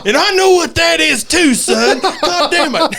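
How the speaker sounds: hammed-up dad losing it; mock outraged dad. Dad finds the stain.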